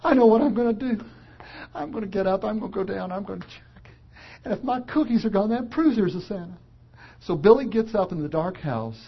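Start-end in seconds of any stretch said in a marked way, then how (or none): 0.0-6.6 put-on high small voice of a boy near tears; a touch of a tearful child; crying little boy. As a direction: talk himself into a brave plan, still upset. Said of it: "and he's crying".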